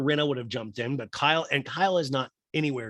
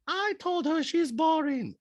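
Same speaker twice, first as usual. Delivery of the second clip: very high-pitched mocking impression, slow and drawn out; Diana; slower than usual. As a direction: mimic a castmate in a squeaky, slow falsetto. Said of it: "And Diana goes".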